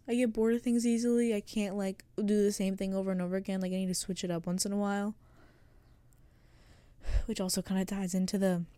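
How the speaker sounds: ordinary talk, nothing marked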